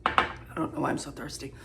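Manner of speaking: fast, soft whispered aside; quick breathy aside to self. Real, not acted.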